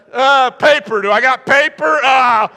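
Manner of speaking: loud, high flustered scramble; hammed-up frantic student; comic panic. Student scrambling for paper in class, comic panic.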